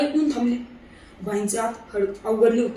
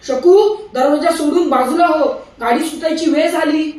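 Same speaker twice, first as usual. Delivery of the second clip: loud, higher desperate shout; shouting with all his might; 4 semitones higher, 8 dB louder than usual. A narrator's reading. Husband yells to wife as train leaves, desperate shout. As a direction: yell at the top of his lungs. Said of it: "जीव खाऊन मोठ्याने ओरडले".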